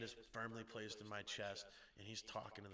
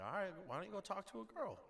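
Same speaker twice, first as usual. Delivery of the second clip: put-on drunk coaxing; 11 semitones higher, faster than usual. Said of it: "obviously not talking this fluently".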